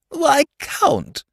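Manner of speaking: a grande dame delighted to see the count; high gushing matron; gushing society lady. A narrator's reading.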